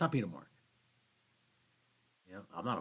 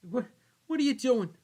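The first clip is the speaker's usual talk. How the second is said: mock outburst; 12 dB louder than usual. Acted outburst.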